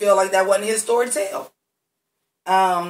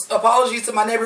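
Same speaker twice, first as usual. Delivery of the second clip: ordinary delivery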